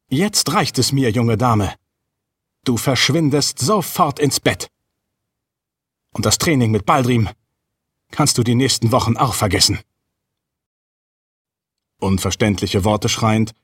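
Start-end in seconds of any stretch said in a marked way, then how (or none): 0.1-9.8 leicht angehobene, verärgerte Mutterstimme; wütende Mutter. A narrator's reading. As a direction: streng die Tochter zurechtweisen, ohne zu brüllen. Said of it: "Wütend fuhr ihre Mutter herum". (verhalten wütend schimpfen)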